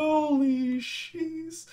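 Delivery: very high, long-held scream of shock; high drawn-out jump-scare cry; real frightened shriek. Real, not acted. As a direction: stretch it out in a high startled wail. Jump scare in horror game.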